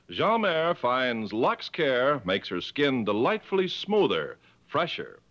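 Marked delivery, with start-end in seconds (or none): none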